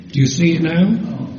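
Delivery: ordinary talk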